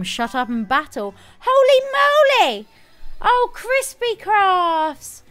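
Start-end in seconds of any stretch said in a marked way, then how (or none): 1.4-2.7 high, loud, drawn-out holy moly; surprised shout. Real, not acted. Streamer startled by a new donation, squealing. 3.2-5.2 high, slow, delighted greeting; excited. Real, not acted. Streamer thanking the donor Crispy Crafts, thrilled.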